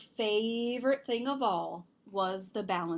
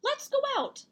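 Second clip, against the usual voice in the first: much higher, bright invitation to the family; mom's cheerful voice; 4 dB louder than usual. Acted. Mom invites family out, voiced much higher. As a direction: lift it up high, eager to go. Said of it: "Zoe's mom said".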